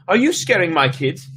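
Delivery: high, loud accusing question; angry mother. Acted. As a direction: confront the stranger hard. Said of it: "her voice shrill and angry".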